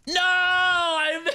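long, high-pitched scream of "no"; anguished scream. Real, not acted. Gamer falling on a short jump, anguished scream. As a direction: cry out high as it all goes wrong.

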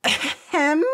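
very high, prim ahem; disapproving fake cough; pointed throat-clearing. A narrator's reading. Principal interrupts the boys.

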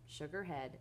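plain reading voice

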